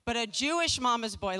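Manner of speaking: normal talking voice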